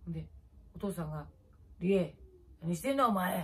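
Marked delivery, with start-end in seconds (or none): 1.8-3.4 loud, stern call of her name; a father catching his drunk daughter, half-shouted; father's mock scolding. Father scolding his drunk daughter, loud and stern.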